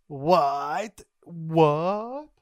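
loud, drawn-out disbelief; loud mock astonishment; shocked. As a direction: stretch out each WHAT in hammed-up shock.

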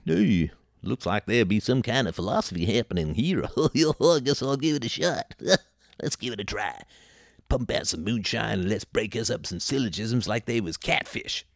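put-on unplaceable backwoods accent, vaguely Tennessee; mock Tennessee accent. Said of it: "space aliens from the planet Tennessee".

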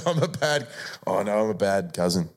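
usual speaking voice

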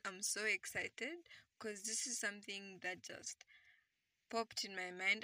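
ordinary talk, nothing marked

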